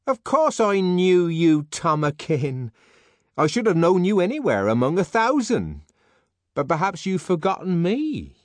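high, loud, coquettish voice; an aging woman clinging to hope; pleading Linda. Acted. Linda reminding the director who she is, high and eager.

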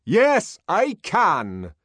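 loud, high, defiant reply; very loud answer. Acted. Bernard answering the director, loud and defiant. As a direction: fire it back at him. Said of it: "Bernard answered in a very loud voice".

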